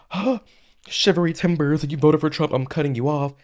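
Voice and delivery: high-pitched mock shock, opening on a gasped huh; mock outrage. Commentator mimicking people who cut off Trump voters, mock outrage. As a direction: exaggerate an overdramatic, scandalized reaction.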